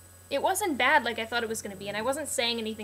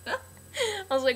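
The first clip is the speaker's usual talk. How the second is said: laughing; 9 semitones higher, much slower than usual. Real, not acted. Laughing at her own question.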